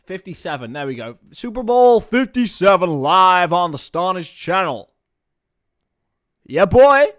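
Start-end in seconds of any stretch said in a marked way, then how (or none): 1.3-4.9 slow, loud, higher mock American announcer voice. Streamer hyping the Super Bowl for viewers in a loud mock American announcer voice. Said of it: "that incredible, uh, accent". 6.4-7.1 hype shout. Pretend. Hype after announcer bit.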